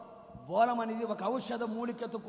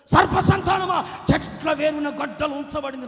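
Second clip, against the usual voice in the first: angry rebuke; 4 semitones higher, 12 dB louder than usual. Retold or imitated. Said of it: "అంటాడు".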